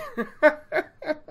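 a real high giggle; embarrassed laugh. Real, not acted. Caught oversharing about her bath, real embarrassed giggle.

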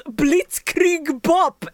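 put-on German accent. Retelling the dub's insane attack line, German accent. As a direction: imitate a German accent, stretching each word. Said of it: "in his German accent".

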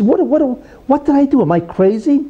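acted-out dismay, pitched high at first; sudden dismayed realisation. Thrown-out son coming to his senses about his father, dismayed. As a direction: play a kid suddenly aghast at himself.

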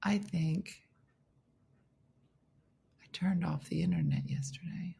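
soft unvoiced whisper to herself; whispered aside. Real, not acted. Vlogger whispering to herself while fixing the phone.

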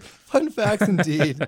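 slow, drawn-out mock gravity; mock solemn. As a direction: savour each word like a pompous announcer. Mock-solemn echo of fun fact.

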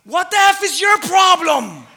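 high, loud and slow furious shout; loud angry shout. Retold or imitated. Other driver shouting back, loud and slow. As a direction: bellow it out the car window. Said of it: "rolls her window and says".

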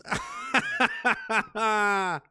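high amused laughter; real laughing fit; laughing at himself. Real, not acted. Laughs at own joke.

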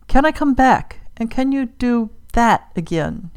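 high, urgent plea; holding back tears. A narrator's reading. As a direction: plead insistently, fighting tears. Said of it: "struggling to keep tears from her eyes".

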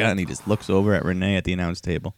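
normal talking voice

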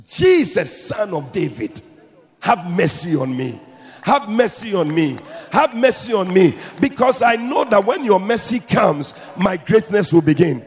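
loud desperate cry for mercy; Bartimaeus shouting. Retold or imitated. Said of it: "Why are you shouting and screaming like that?".

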